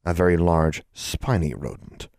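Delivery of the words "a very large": ordinary delivery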